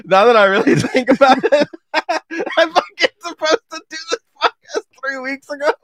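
talking through high giggles, cracking up; laughing. Real, not acted. Podcast host laughing at himself over the late episode. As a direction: laugh out each word as you admit the delay. Laughing at his own confession.

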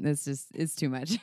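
ordinary talk, nothing marked

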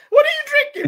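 high, loud, laughing question; laughing tease. Real, not acted. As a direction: tease him through a burst of laughter. Teasing Mopa mid-laugh.

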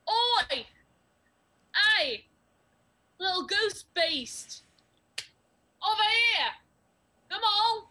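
loud, high shouts to draw attention; yelling a taunt. Acted. Scared adventurer luring the goose monster away, yelling. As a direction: bellow out with false bravado. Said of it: "as you yell".

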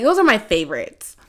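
ordinary talk, nothing marked

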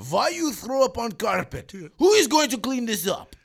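put-on loud, high, outraged Ukrainian accent. Teacher scolding a sick pupil, Ukrainian accent, shouting. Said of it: "Ukrainian teacher".

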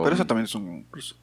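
atragantado, habla rápida y entrecortada; talking fast while choking on chocolate; swallowed wrong way. Real, not acted. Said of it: "me atraganté con el chocolatito". (atragantándose con chocolate)

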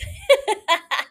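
high cackle at own joke; gleeful high laugh; big laugh. Real, not acted. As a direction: burst out laughing, high-pitched.

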